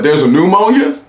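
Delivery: high, rising astonishment; surprised question. Retold or imitated. Friend hears of a new kind, high baffled question. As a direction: ask it as startling news.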